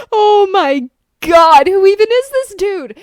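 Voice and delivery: loud, high, incredulous exclamation; stunned, drawn-out outburst; amused disbelief. Real, not acted. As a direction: exclaim it high, just after laughing. Amused disbelief after the reading.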